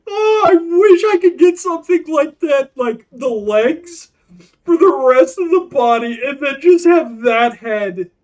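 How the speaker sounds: high, loud, gushing wish; almost shouting with delight; loud excited. Real, not acted. Streamer gushes about the creature legs she wants, loud and high.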